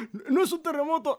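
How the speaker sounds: fast, high-pitched yell; panicked shout. Acted. Mario arguing with housemate about earthquake, fast high yell. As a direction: blurt it loudly in mock alarm.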